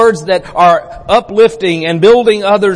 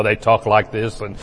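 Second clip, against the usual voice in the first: booming preacher voice; 7 semitones lower, 5 dB quieter than usual. Shown as a demonstration. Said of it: "one of those booming preacher voices".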